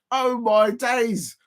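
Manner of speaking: slow, drawn-out exasperation; amused exasperation at the mix-up; exasperated. Real, not acted. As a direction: stretch it out in disbelief. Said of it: "exasperated exclamation".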